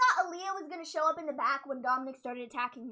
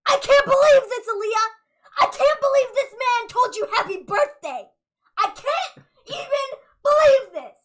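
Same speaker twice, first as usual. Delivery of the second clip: louder, higher mock fury, laughing through it; livid Rey; 5 semitones higher, 8 dB louder than usual. Retelling Rey's rage at the text messages, loud and laughing. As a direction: act out a furious dad, half laughing. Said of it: "Rey was livid".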